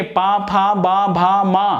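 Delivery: पा फा बा भा मा made with the lips; pa-varga demo. Shown as a demonstration. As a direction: recite पा फा बा भा मा from the lips. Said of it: "practical करिए".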